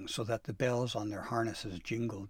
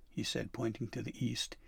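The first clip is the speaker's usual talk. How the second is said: ordinary delivery, nothing marked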